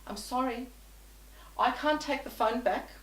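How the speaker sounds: ordinary talk, nothing marked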